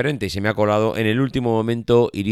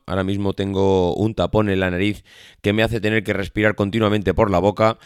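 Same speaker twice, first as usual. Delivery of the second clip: ordinary delivery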